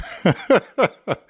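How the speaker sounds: a high burst of giggles. Real, not acted. Teacher laughing at her car example.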